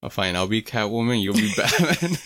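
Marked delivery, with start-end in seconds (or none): 1.2-2.2 said through a laugh, a little higher; giggling while teasing the friend. Real, not acted. Teasing over Batman and Robin.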